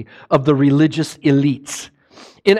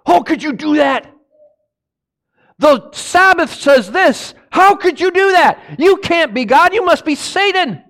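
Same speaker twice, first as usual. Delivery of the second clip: high, accusing outrage; mock outraged religious leader; accusing Pharisees; 11 semitones higher than usual. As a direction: hurl the accusation like an indignant Pharisee. Pharisees accusing Jesus, voiced.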